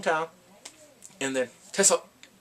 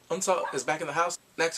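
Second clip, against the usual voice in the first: ordinary talk, nothing marked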